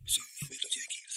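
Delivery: whispered dodge, barely voiced; sheepish whisper. Husband dodging wife's money question, whispering. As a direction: hush it out like changing the subject.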